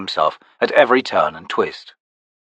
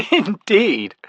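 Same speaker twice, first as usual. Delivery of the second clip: laughing; 10 semitones higher than usual. A narrator's reading. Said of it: "she laughed".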